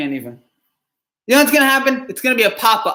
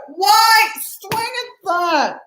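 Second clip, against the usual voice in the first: very high and very slow, every word stretched; drawn-out exasperation; 12 semitones higher, much slower than usual. Real, not acted. Fan repeats his question to the batter, stretched into a high wail. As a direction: wail it out in disbelief.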